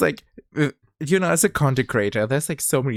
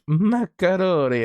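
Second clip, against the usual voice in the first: drawn-out macaroni; 8 semitones higher, 4 dB louder than usual. Retold or imitated. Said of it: "it's like".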